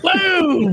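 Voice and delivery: high, loud boom through laughter; laughing mock boom. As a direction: blurt the impact out mid-laugh. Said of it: "you're like".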